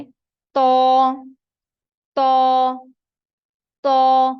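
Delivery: teacher drills "तो" loudly; forceful letter demo. Shown as a demonstration. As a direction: punch each "तो". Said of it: "ये बारीक ता है".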